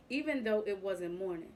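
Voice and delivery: usual speaking voice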